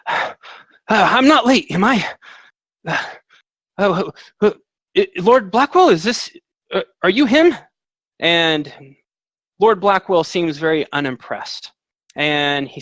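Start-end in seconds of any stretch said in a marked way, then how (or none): 0.1-7.6 high, loud, stammering nervous voice; a breathless young man caught arriving late; flustered latecomer. Acted. Late bard nervously asking if the lord is Blackwell, high and flustered.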